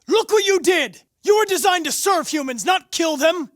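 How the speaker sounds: high, loud shouted accusation; shouting in horror at what was done; furious shout. Acted. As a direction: yell it at him in outrage. Android confronting a killer android.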